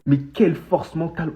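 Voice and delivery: normal talking voice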